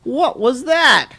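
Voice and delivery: stretched-out, wide-eyed question; exclaiming. A narrator's reading. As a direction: draw it out in amazed wonder. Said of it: "exclaimed Skippy John Jones".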